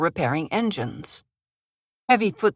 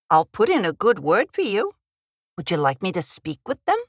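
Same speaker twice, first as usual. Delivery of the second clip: put-on chatty Minnesota accent, lightly done; folksy Minnesota nice accent; 3 semitones higher than usual. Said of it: "I put on a Minnesota accent".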